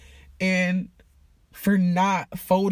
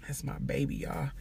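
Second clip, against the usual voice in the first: choked-up whisper; 5 semitones lower, 9 dB quieter than usual. Real, not acted. Moved talking about her niece.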